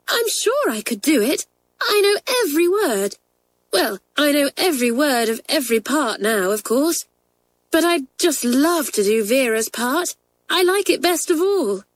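put-on high, keen girl's voice; longing to get the part; eager schoolgirl. A narrator's reading. Sheila tells the girls she'd love the part, eager.

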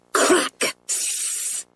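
a sharp crack, then a soft sizzle; imitated firecrack noises. A narrator's reading. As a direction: imitate a bang fizzling into a hiss.

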